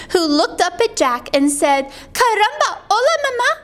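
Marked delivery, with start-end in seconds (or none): 2.1-3.5 high little Spanish-speaking voice; baby chick. Acted. Chick hatches and sees Jack, high baby greeting.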